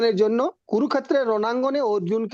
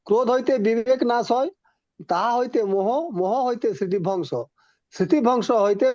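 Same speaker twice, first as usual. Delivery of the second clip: ordinary talk, nothing marked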